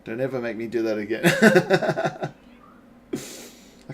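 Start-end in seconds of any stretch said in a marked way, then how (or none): 1.3-2.3 burst of laughter. Real, not acted. Laughs after his French attempt.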